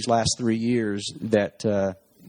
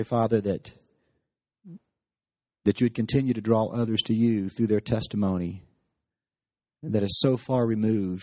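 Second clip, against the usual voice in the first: prayer. Acted. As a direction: pray it unhurried, as to God. Pastor leads closing prayer.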